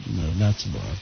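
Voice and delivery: low, soft, dull mumble; low mumbling answer that mishears the name; mumbled family reply. Retold or imitated. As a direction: grunt it out low and quiet, like a sullen family.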